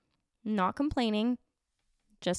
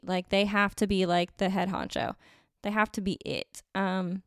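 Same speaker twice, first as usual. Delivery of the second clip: ordinary talk, nothing marked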